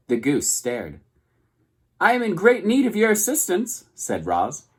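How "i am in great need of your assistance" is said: high, bright booming robot greeting; friendliest robot voice. A narrator's reading. Robot calling across the pond to the goose, high and cheerful. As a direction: boom it out cheerfully across the water.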